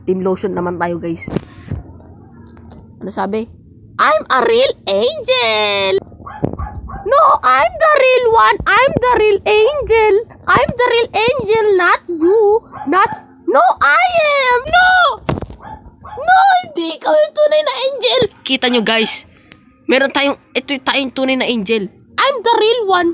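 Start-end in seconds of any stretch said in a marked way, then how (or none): 4.0-18.2 high, squeaky voice insisting, rising to a shout; a petty character claiming to be the real angel. Acted. Two angels bickering over who is real, in a squeaky voice. 22.2-23.1 high, squeaky voice insisting, rising to a shout; a petty character claiming to be the real angel. Acted. Two angels bickering over who is real, in a squeaky voice.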